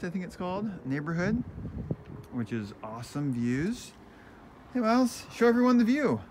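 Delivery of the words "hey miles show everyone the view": calling out. Real, not acted. Calling out to child outside.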